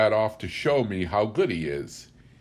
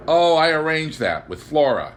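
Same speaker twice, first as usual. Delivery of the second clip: higher, louder, eager answer; ringing readiness; 6 semitones higher, 7 dB louder than usual. A narrator's reading. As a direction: ring it out, proud of the trick. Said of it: "His answers rang out with a readiness".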